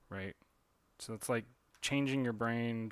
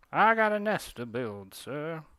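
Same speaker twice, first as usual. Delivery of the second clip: put-on the nest line again, higher and louder with a Southern accent; a proud Southern bird insisting in a Southern accent; 5 semitones higher, 8 dB louder, slower than usual. A Southern bird leaving in a huff, loud Southern accent. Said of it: "it's a southern bird".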